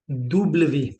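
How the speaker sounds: डब्ल्यू said as double vé; French W. Shown as a demonstration. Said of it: "French में इसको हम बोलेंगे". (French में डब्ल्यू, दो वी जैसा)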